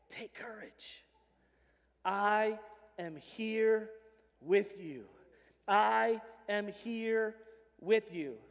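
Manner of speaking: slow, soft, gentle comfort; tender reassurance. Pretend. The Lord comforting a frightened listener, slow and tender. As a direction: speak it like a hand on the shoulder.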